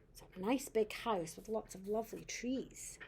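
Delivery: ordinary delivery, nothing marked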